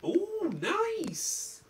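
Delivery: high squeaky admiring ooh. Acted.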